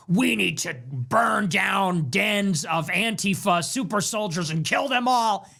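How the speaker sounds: slow, hammed-up war-cry of an online poster; mock tough talk. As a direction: imitate a keyboard warrior talking tough. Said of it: "you see them talking tough on Twitter".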